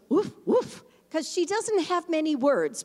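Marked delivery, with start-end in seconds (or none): 0.1-0.8 put-on woof woof barked higher and louder like a little child; imitating toddler's woof. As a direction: play the toddler proudly barking. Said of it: "And Lydia goes".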